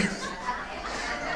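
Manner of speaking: breathy laugh. Real, not acted. Laughing before telling prank.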